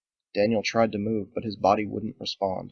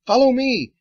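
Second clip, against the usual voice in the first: much higher, louder rallying call; 10 dB louder than usual. Acted. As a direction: lead the group off. Fergus leads them away.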